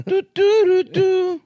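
high sung whistle slide; slide whistle imitation.

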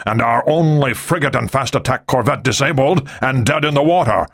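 a hint of a stern naval commander; lightly voiced angry admiral. A narrator's reading. Admiral blaming Ri for his ships, menacing.

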